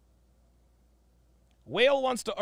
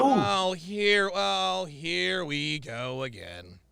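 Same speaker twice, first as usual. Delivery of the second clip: long, slow, falling "here we go again"; drawn-out exasperation; much slower than usual. Real, not acted. Weary reaction to the clip.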